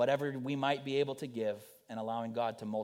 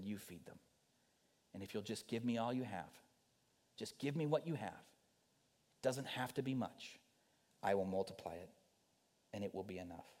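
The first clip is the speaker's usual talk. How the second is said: much softer, calm and sure; gentle voice of Jesus; 7 dB quieter than usual. Acted. Jesus calmly telling his disciples to feed them, softly. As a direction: hand them the task quietly. The Savior's voice to listeners.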